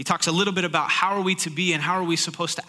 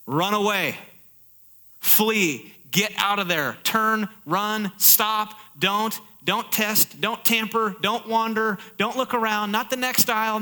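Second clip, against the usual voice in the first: preaching at full force; 4 semitones higher than usual. Acted. Preacher pleading with congregation, urgent preaching then calm. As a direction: urge the congregation to flee, building then calming.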